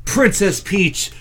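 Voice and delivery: ordinary delivery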